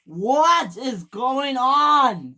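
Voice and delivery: high, slow, drawn-out yell; screamed question. A narrator's reading. Newly arrived engine at the crash, drawn-out shout. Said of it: "screamed the voice".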